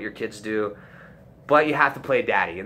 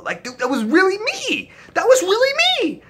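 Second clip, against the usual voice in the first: high, loud insisting; nobody believes you so push harder; claiming credit to doubting classmates, loud; insistent shout; 7 dB louder than usual. Retold or imitated.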